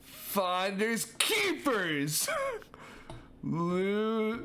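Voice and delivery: slow, high teasing taunt; high, slow, giggly taunt; taunting singsong. Acted. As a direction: drag it out to tease. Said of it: "let me get a good story going".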